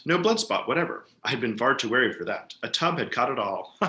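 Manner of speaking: ordinary delivery, nothing marked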